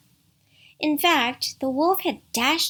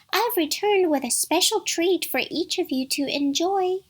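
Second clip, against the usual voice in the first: high singsong mother-goat voice; soft, tender imitation; 9 semitones higher, 3 dB louder than usual. A narrator's reading. Wolf promises a treat as Mother Goat, tender falsetto. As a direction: coax sweetly in a put-on falsetto. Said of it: "made his voice soft and tender".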